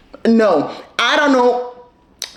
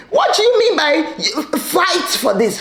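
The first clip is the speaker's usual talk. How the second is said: usual speaking voice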